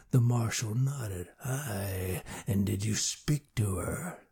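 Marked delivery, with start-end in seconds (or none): 1.4-4.2 much softer, low gruff question. A narrator's reading. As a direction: ask gently but gruffly. Marshal questions frightened girl.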